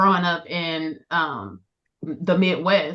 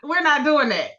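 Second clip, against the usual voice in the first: higher-pitched imitation of a disapproving mother; mock maternal objection, pitched up; correcting mom; 6 semitones higher than usual. Daughter imitating her mom refusing the slang, higher and incredulous. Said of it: "my mom would be like".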